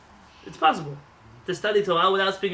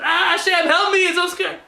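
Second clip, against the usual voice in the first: high, loud mock terrified cry; mock scream on a roller coaster; 13 semitones higher, 10 dB louder, slower than usual. Rider on the roller coaster begging Hashem for help, mock terrified cry. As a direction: yell it like a frightened rider mid-drop.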